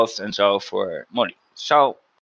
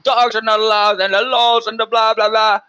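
higher, louder mock rant of an angry manager; retold shouting, higher and louder; imitated screaming; 8 semitones higher, 4 dB louder than usual. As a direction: mimic the manager yelling in your ear, half-mocking. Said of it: "and screaming".

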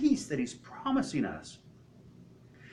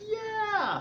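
crowd cheer. Acted. Crowd answering the tightrope walker, excited cheer. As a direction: cheer back like an eager crowd.

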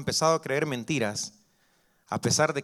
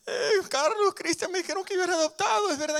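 put-on much higher, pleading child's voice; little boy voice. Preacher as a little boy asking his mom, high and worried. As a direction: ask like a worried small child.

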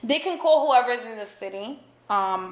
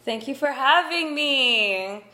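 put-on higher, louder, slow valley-girl thanks; 5 semitones higher, 5 dB louder, slower than usual.

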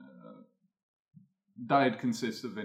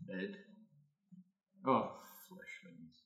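metallic voice; 9 dB quieter than usual. Acted. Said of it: "you hear this metallic voice ring out".

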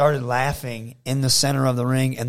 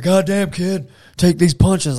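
loud, raised tough-guy wrestler voice; Steve Austin; 6 semitones higher, 7 dB louder, slower than usual. Retold or imitated. Star wrestler berating a younger opponent mid-punch, gruffly forceful. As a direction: order the kid to take it, high and forceful. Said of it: "when Steve Austin would punch you".

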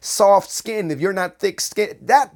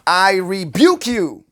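mock dramatic rebuke; 4 semitones higher, 4 dB louder than usual. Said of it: "this is what rebuke looks like".

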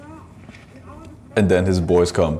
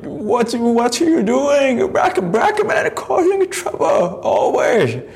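put-on much higher, slow, shouted Korean-accented shopkeeper; mock Korean accent, shrieking at a thief; 3 dB louder, slower than usual. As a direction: play a frantic Korean store owner yelling in accent. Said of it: "the Korean owner, like".

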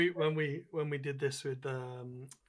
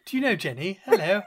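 put-on British accent; 6 semitones higher, 11 dB louder than usual. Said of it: "the best British accent".

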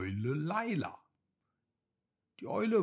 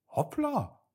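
high, startled little exclamation; a small rabbit gasps at the empty spot; surprised cry; 3 dB louder than usual. A narrator's reading. Rabbit Hopsi discovers his carrot is gone, high surprised cry. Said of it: "rief Hopsi eines Tages aus".

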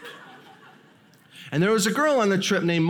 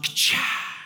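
snake strike noise. Retold or imitated. Snake strike sound effect.

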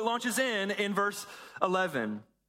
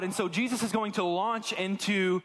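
ordinary delivery

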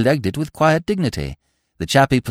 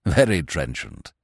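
low, slow and stiff, a stuffy peer; pompous old lord; 11 semitones lower, 3 dB quieter than usual. Acted. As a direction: speak down from great dignity, deep and measured.

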